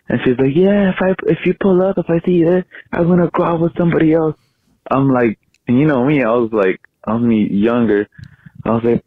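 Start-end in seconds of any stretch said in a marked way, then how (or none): 0.5-4.3 higher voiced mocking girlfriend threat. Ex girlfriend threatening to go out with someone else, higher mocking voice. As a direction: play the snotty ex, pitched up.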